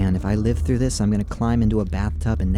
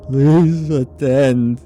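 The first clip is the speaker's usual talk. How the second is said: slow, loud inhuman call; a voice from the walls, drawn out and louder; booming summons; 4 semitones higher, 7 dB louder, much slower than usual. Acted. The city summoning the police again, slow and booming.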